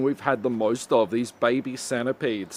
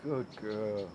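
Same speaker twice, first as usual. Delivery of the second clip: cooing softly; 4 semitones lower, 11 dB quieter than usual. Real, not acted. Praising pet softly.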